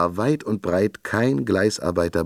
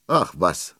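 ordinary delivery, nothing marked

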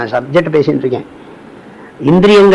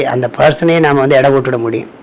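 usual speaking voice

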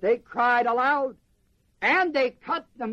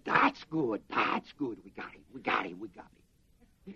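gloating hush; 9 semitones lower, 7 dB quieter than usual. Retold or imitated. Crowd gloating, voiced by preacher.

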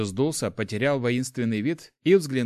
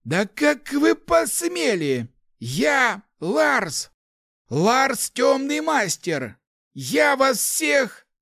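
high, loud, drawn-out indignant roar; outraged threatening; 14 semitones higher, 5 dB louder, much slower than usual. Acted. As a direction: stretch each word into a furious threat. Captive old man threatens his tormentors. (возмущённо, с угрозой, громко и протяжно)

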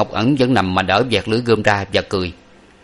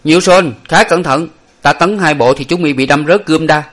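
higher, louder warning to the opponent; calling out loudly; 6 semitones higher, 5 dB louder than usual. A narrator's reading. Swordsman warns his rival mid-duel, loud and high. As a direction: announce your next thrust with confidence. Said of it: "nói lớn lên rằng".

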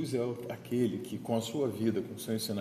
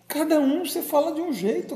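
emphatic exclamation; 7 dB louder than usual. Real, not acted. Emphatic high point of sermon.

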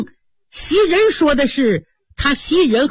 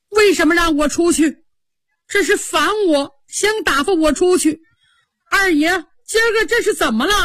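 tearful plea; 8 semitones higher than usual. A narrator's reading. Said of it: "他哭了".

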